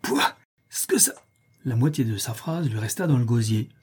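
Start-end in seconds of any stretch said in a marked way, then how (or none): 0.0-1.1 disgusted exclamation. A narrator's reading. Strong has just stepped through the door, disgusted cry cut off. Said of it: "il s'écria".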